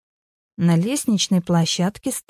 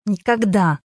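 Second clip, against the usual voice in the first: curt. A narrator's reading. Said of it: "отрезала Вера".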